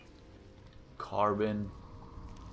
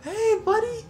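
excited squeal; 4 dB louder, slower than usual. Real, not acted. Player squealing at a happy little alien creature, very high and excited. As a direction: squeal with delight at a cute pet.

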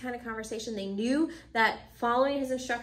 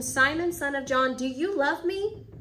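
insistent question; 5 semitones higher, 5 dB louder than usual. Acted. Jesus repeats the question more insistently.

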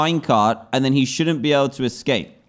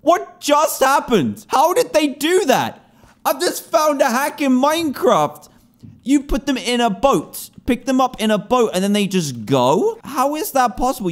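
amazed excitement; 11 semitones higher than usual. Real, not acted. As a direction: shout in amazement at the glitch. Amazed at game glitch.

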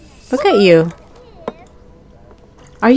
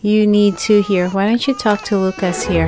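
ordinary talk, nothing marked

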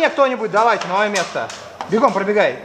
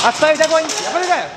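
raised, commanding cease fire; shouted command; 5 semitones higher, faster than usual. Real, not acted. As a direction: bark the order to hold fire. Orders ceasefire while running.

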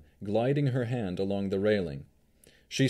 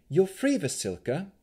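put-on high young woman voice; 10 semitones higher than usual. Navarra voiced.

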